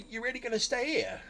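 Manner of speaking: high goblin whine. Acted. Goblin Smudge questioning the player, high pitched. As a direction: goblin asks in disbelief, high. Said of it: "He's like".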